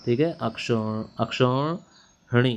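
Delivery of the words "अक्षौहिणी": अक्षौहिणी very slowly, "akshau... hini"; syllable-by-syllable spelling. Shown as a demonstration. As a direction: draw अक्षौहिणी out, separating the "hini". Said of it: "है पे ई की मात्रा".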